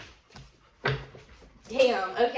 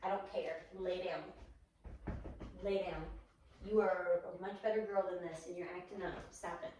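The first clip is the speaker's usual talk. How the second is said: stern scolding; 7 dB quieter than usual. Real, not acted. Scolding dog.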